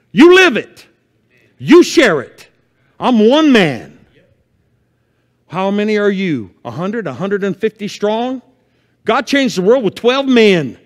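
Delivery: loud, high, urgent sermon voice; preaching at full force. Acted. Pastor warning his church of the end times, preaching hard. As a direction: rouse the church with fire.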